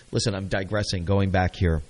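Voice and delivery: normal talking voice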